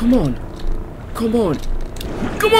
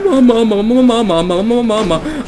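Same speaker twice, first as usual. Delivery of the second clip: scared laughing whimper; 4 semitones higher, 4 dB louder, faster than usual. Real, not acted. Scared, calling mama.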